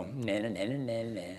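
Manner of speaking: taunting. Pretend. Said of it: "Look at the devil and go".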